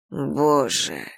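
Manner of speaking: cold, quiet exclamation; icy tone. A narrator's reading. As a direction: say it icily. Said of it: "произнесла королева ледяным тоном".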